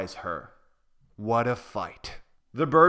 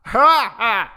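loud hammed-up mocking laugh; mocking rhino laugh; 11 semitones higher, 10 dB louder than usual. The rhino laughing scornfully at the little ostrich. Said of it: "The rhino started to laugh".

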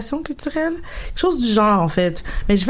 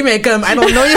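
laughing; 3 semitones higher, 7 dB louder than usual. Real, not acted. Joking about not knowing Valérie.

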